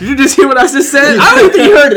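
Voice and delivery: loud, high, laughing disbelief; exasperated. Retold or imitated. Worker to customer who wasn't listening, loud laughing exasperation. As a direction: raise your voice incredulously through laughter.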